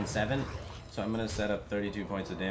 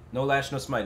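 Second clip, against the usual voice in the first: raised, urgent chant; excited plea; 5 semitones higher, 7 dB louder than usual. Real, not acted. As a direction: beg the game for luck. Pleading at the game screen.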